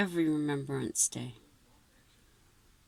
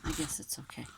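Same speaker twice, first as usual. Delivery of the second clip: whispering to herself; hushed, muttered under breath; whispered aside; 4 semitones lower, 7 dB quieter, faster than usual. Real, not acted. Aside while child settles.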